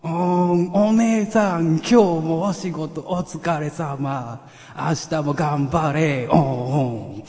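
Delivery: very loud, high, drawn-out character bellow; Nyanchu impression. Performer thanking big sister for today's work, Nyanchu impression. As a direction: howl it slowly with long moaned vowels. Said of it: "にゃんちゅうで頑張れ".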